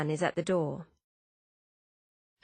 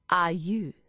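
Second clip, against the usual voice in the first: put-on French-accented questioning; heavy French accent. A narrator's reading. Philippe eyeing Hannah up and down, French accent. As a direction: size her up in a French accent. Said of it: "in a heavy French accent".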